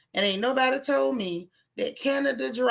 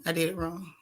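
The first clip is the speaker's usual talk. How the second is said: repeated much softer under the breath; soft self-correction; 3 semitones lower, 7 dB quieter than usual. Shown as a demonstration. Streamer catching her own mistake, repeating it softly. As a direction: drop the volume, half to yourself.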